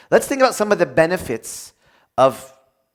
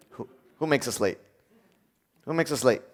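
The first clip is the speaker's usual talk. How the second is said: ordinary talk, nothing marked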